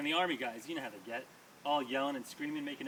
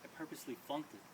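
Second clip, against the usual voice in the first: quietly confiding a secret; whispered; 9 dB quieter, faster than usual. Retold or imitated. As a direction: lean in and say it hushed. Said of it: "My dad whispers".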